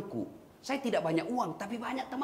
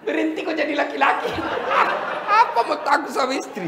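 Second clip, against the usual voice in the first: higher and louder, laughing; laughing tease; 7 semitones higher, 7 dB louder than usual. Acted. Preacher teasing men afraid of their wives, laughing loud. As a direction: jeer through a laugh.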